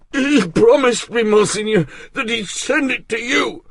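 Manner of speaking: high, loud frightened plea; frightened servant. Acted. Bersamet pleads with Artois, frightened. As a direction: plead breathlessly.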